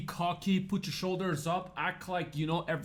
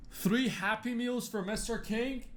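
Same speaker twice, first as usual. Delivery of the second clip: slow, high-pitched counter call; mock order call-out; 7 semitones higher than usual. Restaurant worker calls out his takeaway order, mock announcing. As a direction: sing out the order, drawn out and high.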